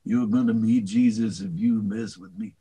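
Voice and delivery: gruff understated tough-guy voice; Charles Bronson impression. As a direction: menace him calmly like a movie hardman. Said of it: "Charles Bronson was just kind of".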